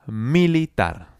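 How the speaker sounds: slow, careful Militar; slow model word. Shown as a demonstration. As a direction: draw Militar out slowly for copying. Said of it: "Siguiente palabra".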